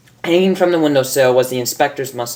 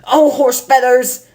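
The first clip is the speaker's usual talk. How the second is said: exasperated curse; 11 semitones higher, 4 dB louder than usual. Acted. Rainbow exasperated.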